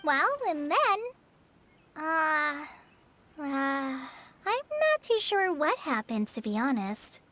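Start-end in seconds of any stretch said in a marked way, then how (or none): 2.0-6.3 slow, halting and unsure; hesitant stalling. Acted. Trainee cannot answer visitors' question about the archon, hesitant. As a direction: stall on drawn-out filler sounds, then answer slowly.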